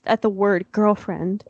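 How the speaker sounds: ordinary talk, nothing marked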